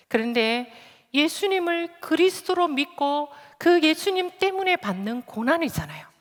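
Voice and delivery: ordinary talk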